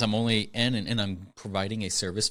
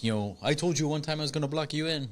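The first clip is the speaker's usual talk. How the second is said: higher-pitched, lightly put-on African accent; imitated African accent; 6 semitones higher than usual. Imitating business owner to mail carrier, African accent. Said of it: "African accent".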